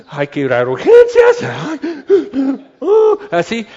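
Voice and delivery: loud, gasping first-time parent panic; mock panic. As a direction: panic over the baby, hammed up.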